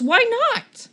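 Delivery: high loud why not; shouting. Acted. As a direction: shout it in protest. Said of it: "she shouts".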